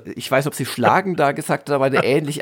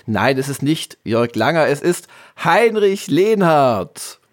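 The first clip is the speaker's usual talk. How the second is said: slow, loud circus ringmaster call; loud carnival barker; 3 dB louder than usual. Pretend. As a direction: drum up the crowd like a ringmaster. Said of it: "der große Spieleveteran-Zirkus".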